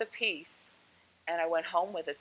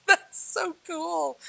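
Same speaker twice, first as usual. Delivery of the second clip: high, slow, drawn-out delight; wowed, lingering high on every word; gushing awe; 11 semitones higher, 3 dB louder, much slower than usual. Retold or imitated. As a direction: gush in amazement, stretching it out. Gushing at the green light.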